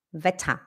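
"Wetter" said low as "veta"; correct pronunciation. Shown as a demonstration. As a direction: model "Wetter" with soft final a. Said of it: "é Wetter". (pronúncia correta: "veta")

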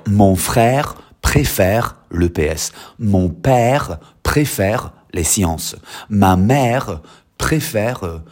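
very slow, careful French; slow teacher dictation. Shown as a demonstration. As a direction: spell it out slowly for the class. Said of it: "I want that spelling right".

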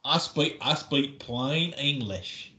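slow, drawn-out Southern drawl; hammed-up Southern, proudly insisting; exaggerated Southern accent. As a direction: stretch each word in mock Southern. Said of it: "it's like a Southern song".

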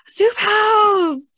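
high, loud excited squeal; excited shout. Retold or imitated. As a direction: shout it like a thrilled kid. Kids excited at special plates.

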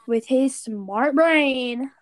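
slow, loud, drawn-out sarcasm; sarcastic. Real, not acted. Teasing dad over his haunted house plan, slow sarcasm. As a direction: stretch out the mock praise. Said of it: "said sarcastically".

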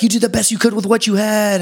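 high, sustained put-on voice; high-pitched mock plea; high bit voice. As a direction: deliver it high and held on one level. Acting out bit's pleading lines.

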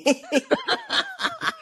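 very high-pitched, squealing laugh; embarrassed-sounding high giggle. Real, not acted. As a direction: laugh high at being put on the spot. Laughs when put on the spot.

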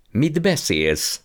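put-on outraged uncle crying out at the boy's suggestion; indignant outcry. A narrator's reading. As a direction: recoil in offended disbelief. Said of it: "kiáltott fel".

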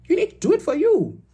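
put-on high-pitched, emphatic African-accented dad; dad's African voice. Quoting dad's constant advice, in a high African accent. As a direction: imitate an African father laying down the rule. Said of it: "what my dad says in his African voice".

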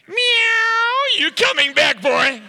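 very high, loud meow into a teasing call; squealed comic cat voice; mock cat yowl. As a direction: yowl like a cat, then taunt him. Teases waiter about reincarnation as cat.

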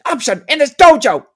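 hammed-up tough karate coach laying down the law; Cobra Kai sensei impression. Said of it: "he's the coach of the Cobra Kai".

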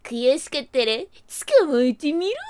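taunting. Acted. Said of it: "からかいました".

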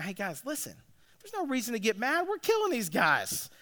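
soft huddle pep talk; calm coach. Retold or imitated. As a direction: settle the team in a hushed, easy voice. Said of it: "Keep your cool".

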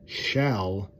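shall set apart, loud and held; stress on shall. Shown as a demonstration. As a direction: hit the word shall hard before the pause. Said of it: "I put a lot of emphasis in the word shall".

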